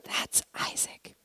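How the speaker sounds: slow, very soft whisper; confiding whisper. Retold or imitated. Son pointing out a friend to mom, slow whisper. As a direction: whisper it like pointing someone out secretly.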